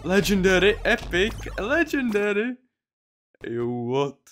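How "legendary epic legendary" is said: excited. Real, not acted. Excited at loot drop.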